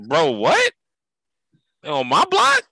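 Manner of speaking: high, loud mock outrage. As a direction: squeal it like an outraged homeboy.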